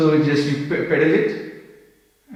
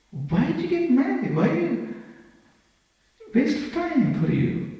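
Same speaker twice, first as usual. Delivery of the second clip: much higher, incredulous shocked friends; 10 semitones higher than usual. Retold or imitated. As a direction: play the friends in disbelief. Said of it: "my social world was shocked".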